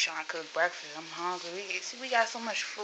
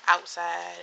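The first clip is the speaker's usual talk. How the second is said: normal talking voice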